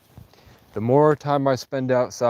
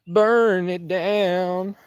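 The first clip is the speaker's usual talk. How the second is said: mock menacing; 8 semitones higher, 4 dB louder, much slower than usual. Mock menacing call to burn towns.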